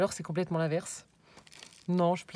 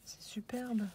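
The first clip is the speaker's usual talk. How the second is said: whisper; 3 semitones higher, 5 dB quieter, slower than usual. Real, not acted. Admiring a craft detail aloud.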